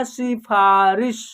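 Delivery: slow, syllable by syllable; slow clear word demo. Shown as a demonstration. Teacher reading a word to pupils, slowly and clearly. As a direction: sound it out slowly for the class. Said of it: "क्या उच्चारण होगा".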